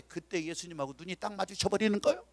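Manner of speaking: ordinary talk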